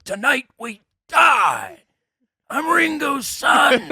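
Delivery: very high, stretched-out mock yells; imitated manic yelling. Retelling how a wired friend ran around, high manic yelling. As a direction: holler each phrase like a crazed festival prophet.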